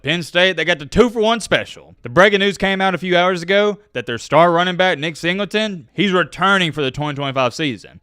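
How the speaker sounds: ordinary talk, nothing marked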